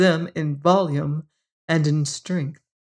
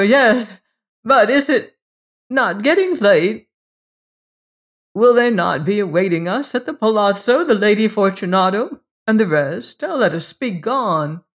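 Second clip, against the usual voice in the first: high, loud, hurried plea; anxious pleading; 4 semitones higher, 5 dB louder than usual. Acted. Fortunato begging to leave the vault: high, fast, anxious. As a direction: rush it, pretending all is fine. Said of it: "The voice said".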